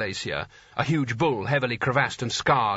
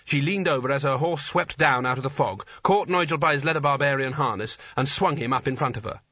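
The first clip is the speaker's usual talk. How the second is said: ordinary delivery, nothing marked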